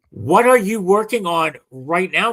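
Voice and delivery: usual speaking voice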